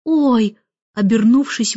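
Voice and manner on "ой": small startled cry; lightly acted exclamation; exclaimed. A narrator's reading. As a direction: let out a surprised oh. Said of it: "воскликнул Майкл".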